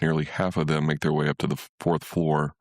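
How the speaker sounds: ordinary delivery, nothing marked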